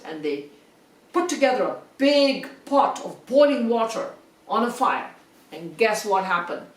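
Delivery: ordinary delivery, nothing marked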